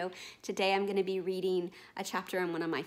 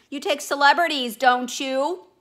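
loud, higher, pushy boatman; 7 semitones higher, 13 dB louder than usual. Acted. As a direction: corner the clerk with it. Boatman presses the clerk.

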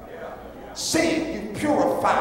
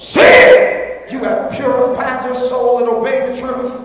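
emphatic preaching; 5 dB louder than usual. Real, not acted. Preacher stressing a verse to the congregation, emphatic preaching.

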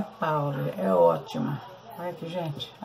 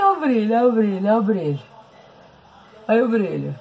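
usual speaking voice

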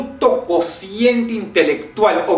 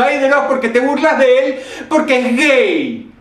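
mocking whiny critic; 8 semitones higher, 6 dB louder than usual. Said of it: "más de un huevón que nunca falte diciendo".

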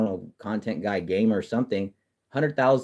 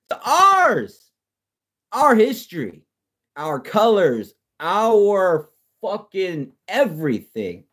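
much higher, louder, slow wailing falsetto; mock whiny outrage; 11 semitones higher, 5 dB louder, slower than usual. Fan to viewers claiming team history, mock tearful wail. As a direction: wail it like a fake sob over stolen colors.

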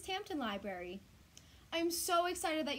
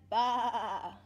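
loud imitated sheep baa; sheep bleat; 3 semitones lower, 6 dB louder than usual. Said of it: "Sheep said".

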